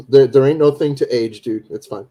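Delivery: ordinary delivery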